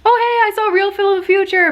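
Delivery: high, loud, put-on breezy voice; imitating a perky hello; acted-out text message. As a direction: read your own awkward text in a mock-casual high voice. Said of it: "text the other guy and say".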